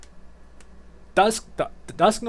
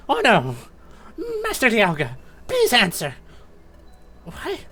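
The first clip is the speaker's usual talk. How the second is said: high-pitched, panicked minion voice; freaked-out Sableye; 10 semitones higher than usual. Acted. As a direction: plead in a frantic high creature voice. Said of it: "all the Sableye are freaked out".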